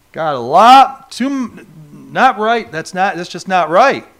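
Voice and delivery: hammed-up scandalized church member, rising to a whoop; mock disapproving churchgoer. Preacher mocking conservatives who frown on passion. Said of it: "have a tendency of".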